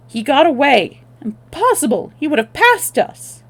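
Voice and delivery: loud, high disbelief; furious. Acted. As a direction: rage at the escape. Conrad furious at escape.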